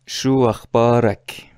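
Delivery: شو اخبارک with a short fatha on ک, slow and clear; Levantine for a man. Shown as a demonstration. Teacher modelling the Levantine greeting for a man, slowly. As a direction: say شو اخبارک as "shu akhbarak", open a on ak. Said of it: "به آقا گفتیم که ضمیری".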